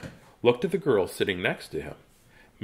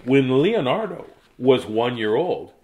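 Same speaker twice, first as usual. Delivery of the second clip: ordinary delivery, nothing marked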